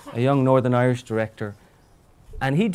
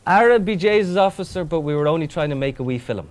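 exaggerated comic Irish brogue; corny Irish accent; 2 semitones higher than usual. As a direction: lay the Irish accent on thick to charm the officer. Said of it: "in my corniest Irish accent".

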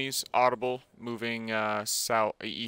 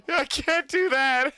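laughing; slower than usual. Real, not acted. Laughing, high pitched.

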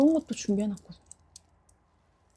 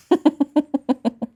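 creepy laugh; 3 semitones higher, 7 dB louder than usual. Acted. Killer laughs about his victim.

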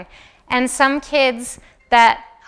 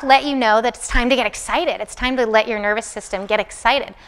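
usual speaking voice

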